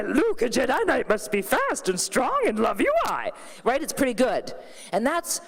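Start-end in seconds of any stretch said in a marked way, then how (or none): 0.0-3.3 Yoda voice with twisted word order. Retold or imitated. Comic doing her Yoda for the kids, put-on Yoda voice. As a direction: croak it out like the little Jedi master. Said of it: "I do a killer Yoda impression".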